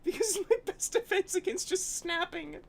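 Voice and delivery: high, cry-like laughter through the words; barely holding it together, laughing; breaking up laughing. Real, not acted. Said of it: "before I break again".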